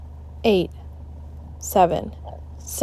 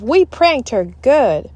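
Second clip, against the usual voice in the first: gleeful gloat; 5 semitones higher, 6 dB louder, slower than usual. A narrator's reading. Kid gloating over prank.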